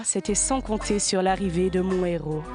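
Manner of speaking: ordinary talk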